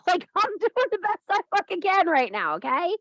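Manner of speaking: high, laughing through the words. Real, not acted. Laughing, high, after cat bit.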